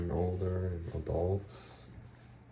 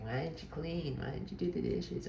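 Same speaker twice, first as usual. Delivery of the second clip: put-on upset complaint, pitched up; imitating upset nagging; 9 semitones higher than usual. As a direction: nag in a higher imitating voice. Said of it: "Tay's gonna get upset with me saying".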